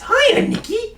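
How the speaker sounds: very high, loud clown greeting; sing-song creepy clown invitation; silly clown. Acted. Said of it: "I'm not tempted by clown voice".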